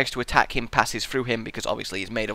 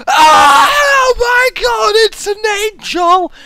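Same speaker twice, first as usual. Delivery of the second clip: loud, high hammed-up panic; mock terrified scream; 10 dB louder, much slower than usual. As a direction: scream in pretend terror, drawn out. Acts reaction to weeping angel.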